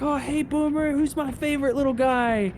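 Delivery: high, gushing voice for a dog; doting pet talk. Retold or imitated. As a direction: coo excitedly at a beloved dog. Abby greeting her dog.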